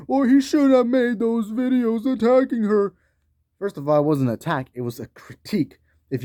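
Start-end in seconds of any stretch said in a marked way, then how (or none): 0.1-2.9 higher, slow whiny mock of his critics. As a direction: sneer it out in a high nasal-free whine. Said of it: "people who are gonna be like".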